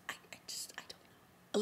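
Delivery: breathless whisper, lost for words; whispered disbelief. Real, not acted. To herself about the kiss, a whispered disbelief. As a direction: whisper it, too stunned to finish.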